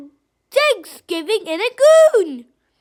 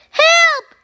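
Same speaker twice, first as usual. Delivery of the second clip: shouted help; 7 semitones higher, 6 dB louder than usual. Acted. Nanny Plum calls for help.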